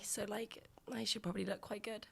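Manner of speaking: soft unvoiced whisper. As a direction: whisper it to yourself. Whispering thoughts on the train.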